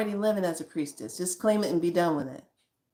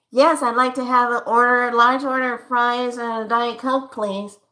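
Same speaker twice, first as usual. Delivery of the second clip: louder customer repeating the order; 3 semitones higher, 5 dB louder than usual. Acted. Customer repeating the order louder for the worker. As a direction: say it again, louder and clearer. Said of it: "Perfect example".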